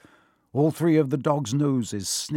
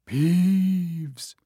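loud, hoarse ghostly stage whisper, pitched up; 6 semitones higher, 4 dB louder than usual. A narrator's reading. Harry poses as a ghost to scare Peeves, loud hoarse whisper. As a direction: draw the name out like a spectre. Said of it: "he said in a hoarse whisper".

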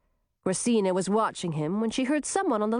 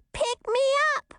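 squeaky doll voice. Acted. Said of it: "said the doll".

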